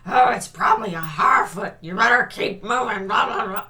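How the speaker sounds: loud mock-gruff grizzled voice; hammed-up gravelly old-hand voice; gravelly know-it-all. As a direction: growl it like the grizzled one who knows it all. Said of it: "deep, gravelly voiced one who knows all this stuff".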